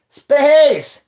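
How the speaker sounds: loud, high bark of the word; mock shout. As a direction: blast the word like a punchline. Mocking friend's plea for space.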